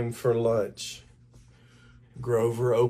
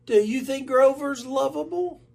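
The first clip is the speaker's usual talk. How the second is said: high monster voice asking shyly; hopeful Grover; 14 semitones higher than usual. A narrator's reading. Grover asking his mother, high and hopeful.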